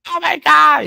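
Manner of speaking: high, loud imitation of a wail; mock crying. Man imitates woman caught out, mock crying. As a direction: wail it in a hammed-up falsetto. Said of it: "crying and crying by the wall".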